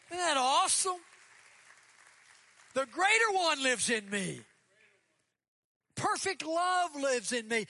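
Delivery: emphatic pulpit shout, stretching key words; full-force preaching. Real, not acted. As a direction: proclaim it high and loud to the congregation.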